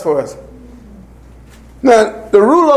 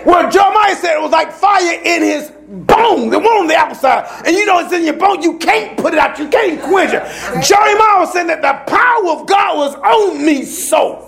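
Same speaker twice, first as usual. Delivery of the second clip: preaching at full force; 6 semitones higher than usual. Acted. Preacher warning the church about controlling men, full force. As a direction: press the point home from the pulpit.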